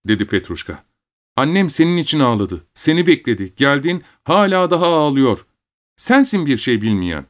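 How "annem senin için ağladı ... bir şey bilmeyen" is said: put-on high-pitched child's reproach; young boy, reproachful. A narrator's reading. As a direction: stand up for mother as her small son. Son defends his mother. (annesini savunan sitemli küçük oğlan)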